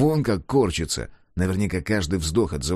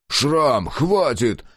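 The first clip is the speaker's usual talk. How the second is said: higher, louder shout to stop him; 5 semitones higher, 4 dB louder, much slower than usual. A narrator's reading. Klaus shouts at Scar to stop the beating. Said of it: "крикнул Клаус".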